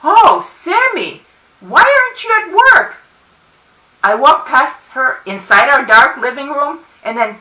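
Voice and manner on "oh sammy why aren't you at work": high, surprised grandmotherly scolding; startled reproach. A narrator's reading. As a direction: react with dismay at seeing them home. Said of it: "looks up at me with startled reproach".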